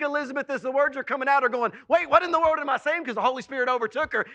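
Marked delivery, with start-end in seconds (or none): 1.9-3.0 loud, high, fast startled aside; startled disbelief. Acted. Preacher voicing Elizabeth amazed at her own words, startled disbelief. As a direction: rush it out, amazed at yourself.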